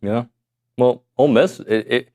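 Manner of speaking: ordinary delivery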